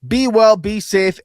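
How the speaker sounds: usual speaking voice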